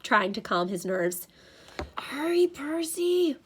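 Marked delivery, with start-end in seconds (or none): 2.0-3.4 slow, high, anxious plea; anxious mutter. A narrator's reading. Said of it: "he muttered".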